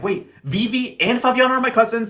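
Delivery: high-pitched disbelieving question; mock incredulity. Josiana learns who her cousins are, mock incredulity. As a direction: act out appalled surprise, voice lifted.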